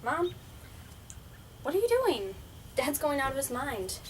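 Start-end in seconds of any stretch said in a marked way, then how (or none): 0.0-2.4 calling out anxiously, raised voice. Acted. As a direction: call out searching for someone. Searching for mother, calling out.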